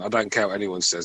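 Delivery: ordinary delivery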